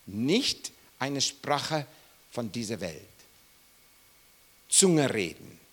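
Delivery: ordinary talk, nothing marked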